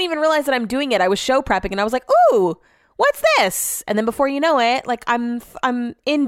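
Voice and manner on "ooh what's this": a much higher, playful ooh; mock curious delight. To her listeners, replaying getting hooked on an ad, in playful mock delight. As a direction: act out being lured into the video. Retold finding a commercial while prepping.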